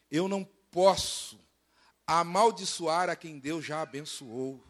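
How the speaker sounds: slow, deliberate quoted refusal; quoted sorcerer's declaration. Acted. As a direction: spell it out slowly and solemnly. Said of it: "E ele fala assim".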